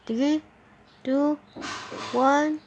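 rising excited countdown. Real, not acted. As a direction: build the count up. Building countdown.

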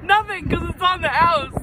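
excited. Real, not acted. Delivering the punchline eagerly.

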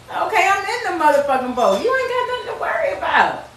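high, easy, amused voice waving off the panic; unbothered Jesus. Retold or imitated. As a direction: brush off their fear from the boat, all cool. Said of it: "And he's like".

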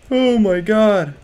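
high, slow "oh my God" of dread; startled gamer's dismay; alarmed. Real, not acted. Startled by someone in game.

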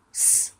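S as a pure voiceless hiss; letter sound demo. Shown as a demonstration. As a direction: sound out S as "sss", no vowel. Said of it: "diye okunur". (S'yi "sss" diye tısla)

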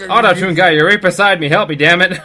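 urgent call. Real, not acted. Calling teammate for help.

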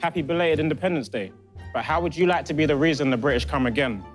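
usual speaking voice

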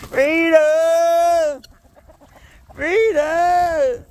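loud, high rallying cry of freedom; joyful shout. Real, not acted. Farmer shouting to his released birds, loud triumphant cry. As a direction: bellow it out as the gate opens.

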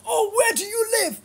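high, fast mocking whine; mock the nosy commenter in a high pitch; mocking whiny fan voice. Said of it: "and tell me".

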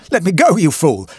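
high, loud panicked gasp; frantic and out of breath; terrified man gasping at the doctor. Acted. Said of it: "I gasped".

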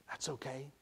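soft, hushed reassurance; gently hushed; whispered back. A narrator's reading. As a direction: reassure him in a near whisper. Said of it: "Rennie whispered back".

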